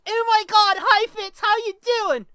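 put-on high, loud girly falsetto; Brittany. Shown as a demonstration. Host springing his girl character on co-host, high loud girly voice. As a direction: shriek a greeting as an over-the-top girl. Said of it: "girl voice too".

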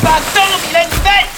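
loud shout in the fight; urgent shout. Acted. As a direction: yell it out in alarm. Crie en affrontant le crocodile. (cri d'alerte puissant)

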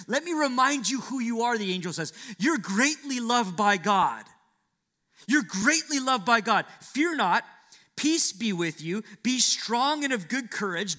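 loud, high, emphatic comfort; angel's reassurance, preached. Acted. Angel reassuring weary Daniel, loud preaching. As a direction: lift them up like the angel would.

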